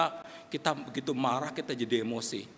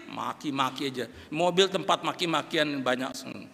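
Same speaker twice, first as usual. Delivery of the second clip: normal talking voice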